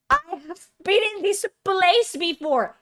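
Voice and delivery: high, loud agitated outburst; ranting breakdown. Real, not acted. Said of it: "I'm having a fucking mental breakdown".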